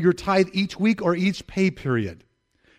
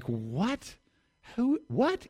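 incredulous questions, pitch leaping upward; mock bewildered surprise; acted astonishment; 9 semitones higher, 6 dB quieter, much slower than usual. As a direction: play stunned disbelief, without raising the volume. Acts out astonishment at surprise check.

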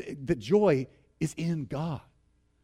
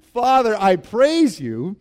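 louder praise broken by laughter; full of joy; 5 semitones higher, 6 dB louder than usual. Acted. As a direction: pray it laughing, bubbling with joy. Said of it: "full of joy".

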